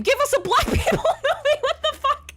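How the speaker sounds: very high, loud, breaking into laughter; cracking up mid-rant; laughing in exasperation. Real, not acted. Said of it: "it makes me mad".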